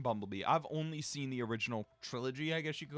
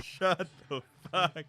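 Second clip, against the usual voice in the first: shout; slower than usual. Real, not acted. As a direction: cry out in frustration. Shouting at movie scene.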